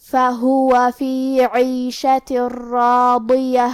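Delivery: slow, melodic tajweed chant; Quran recitation. Acted. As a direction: recite each word drawn out like in prayer. Quran recitation during lesson.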